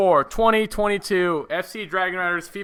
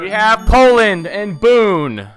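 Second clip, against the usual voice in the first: dramatic reveal; 5 semitones higher, 10 dB louder, much slower than usual. Real, not acted. Announcing the drawn team.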